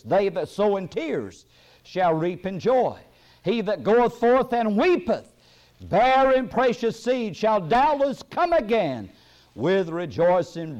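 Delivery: fervent scripture preaching. Acted. Preacher reading promise of joy after tears, fervent preaching. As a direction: proclaim each phrase slowly and high.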